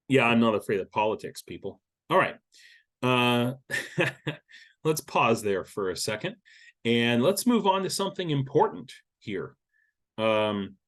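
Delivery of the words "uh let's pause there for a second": normal talking voice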